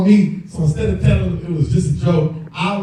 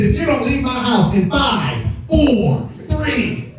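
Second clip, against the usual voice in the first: slow rising countdown, getting higher; dad's countdown; 7 semitones higher, much slower than usual. Retold or imitated. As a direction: count him down like a fed-up dad. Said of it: "He said".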